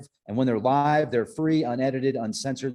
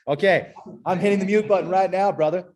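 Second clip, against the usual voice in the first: laughing; 5 semitones higher, 4 dB louder, faster than usual. Real, not acted. Laughing after botched impression.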